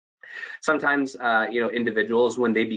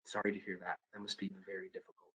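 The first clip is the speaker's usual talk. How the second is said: empathetic tone; 3 semitones lower, 3 dB quieter, faster than usual. Shown as a demonstration. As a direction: let your voice carry the sorrow. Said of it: "show empathy with your tone".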